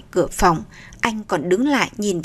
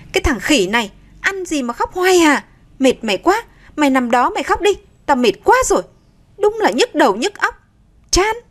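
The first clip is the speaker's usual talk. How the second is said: put-on high, fed-up scolding of a crying baby; exasperated scolding; 6 semitones higher than usual. Exhausted mother snapping at her crying baby, exasperated. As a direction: snap at the baby, worn out and irritated. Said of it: "nổi cáu lên".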